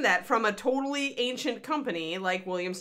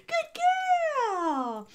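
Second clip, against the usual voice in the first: pet talk; 3 dB louder, much slower than usual. Real, not acted. Praising the dog.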